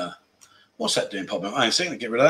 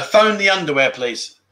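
usual speaking voice

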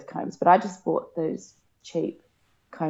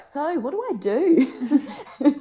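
slow, higher, laughing through it; laughing question; 8 semitones higher, much slower than usual. Retold or imitated. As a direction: ask it half-laughing at herself. Retold naive question, laughing.

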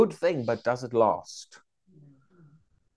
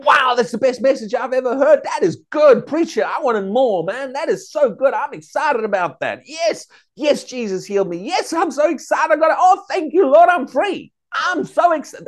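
gushing excitement; 11 semitones higher, 7 dB louder than usual. Retold or imitated. Acting out a glad new believer.

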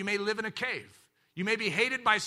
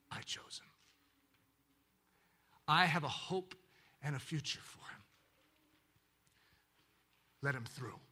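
soft loving tone; 3 semitones lower than usual. Acted. Shepherd king welcoming the weak man in, soft and loving. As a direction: speak gently to a frightened newcomer. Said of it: "his voice changes into a very soft, loving tone".

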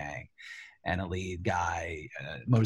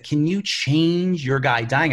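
higher, louder, slow exec voice; casual network exec; 7 semitones higher, 8 dB louder, slower than usual. Retold or imitated. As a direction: ask it like a breezy executive. Network exec asking change.

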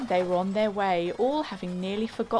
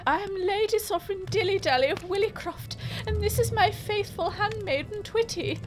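high, haughty noblewoman voice; haughty high lady; 11 semitones higher than usual. Acted. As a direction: announce yourself like a grand lady. Dilly introduces herself.

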